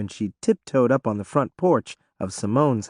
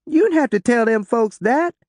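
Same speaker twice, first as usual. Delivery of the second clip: whimpered; 10 semitones higher, 4 dB louder than usual. A narrator's reading. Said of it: "she whimpered".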